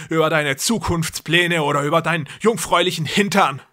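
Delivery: loud and grandiose, playing a god; deranged brother. A narrator's reading. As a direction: brother lecturing his trapped sister. Jakob preaching at sister.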